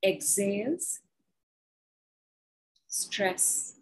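slow, measured guided statements; guided affirmation. Acted. Guiding the group through breath work, slow and measured. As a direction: lay each phrase down slowly for the group.

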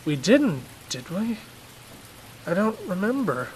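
a hint of a flustered dad; lightly voiced, unsure father.

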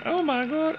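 high mock shriek of surprise; shocked shriek. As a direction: shriek like you just saw something awful. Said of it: "'cause she was like".

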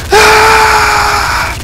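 sudden shrill shriek; high scream. Real, not acted. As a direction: scream in fright.

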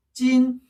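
"찐" high, long and level; first tone. Shown as a demonstration. Teacher answering for this year, "찐" high level tone. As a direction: hold "찐" steady on a high note. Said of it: "솔 톤이죠".

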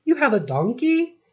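surprised. A narrator's reading. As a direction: caught off guard by the news. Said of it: "asked Emily in surprise".

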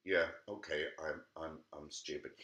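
a crushed wolf giving in; defeated wolf. Acted. Wolf gives in.

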